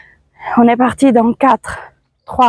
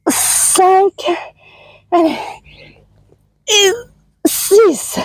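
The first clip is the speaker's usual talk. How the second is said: strained higher voice counting reps; doing push ups; 6 semitones higher, much slower than usual. Real, not acted. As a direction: count out through the effort.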